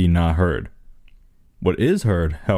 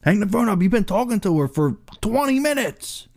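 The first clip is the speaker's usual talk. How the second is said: much higher whiny nag; pitched-up mock complaint; mock nagging buddy; 13 semitones higher than usual. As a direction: whine at him like an impatient friend. Friend voiced nagging.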